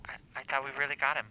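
fast confused whisper. Real, not acted. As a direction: mutter it quickly under your breath. Confused, speaks under his breath.

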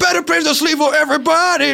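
high-pitched sing-out echo; hype echo. Pretend. Hype man echoes the ad line.

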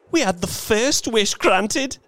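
put-on high, urgent old woman; feverish. A narrator's reading. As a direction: argue with feverish eagerness. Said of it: "said the old woman feverishly".

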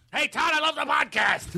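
high-pitched hammed-up fan; imagined painter caller. Painter phoning the podcast, hammed-up excited caller. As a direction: call out high like a busy artist. Painter calling in mid-work.

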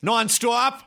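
high, rising Boston nonstop with a nasal o; caricatured Boston question; Boston accent. Retold or imitated. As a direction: ask it like a Boston driver. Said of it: "the most caricaturized Boston accent".

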